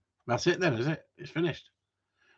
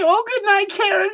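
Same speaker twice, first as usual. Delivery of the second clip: much higher, put-on lovey-dovey voice; mock sweet woman; 5 dB louder than usual. Mimicking a viewer saying goodnight to Kieran, high and sugary. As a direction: coo it like a smitten girlfriend. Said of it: "she says".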